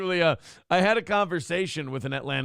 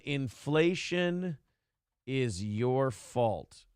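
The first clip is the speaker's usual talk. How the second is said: very slow, softer, lower ominous reading of "inflation is your fault"; 5 semitones lower, 5 dB quieter, much slower than usual. Shown as a demonstration. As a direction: drag out "inflation is your fault" like a dire verdict, low and soft. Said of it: "rereads title, slower and hushed".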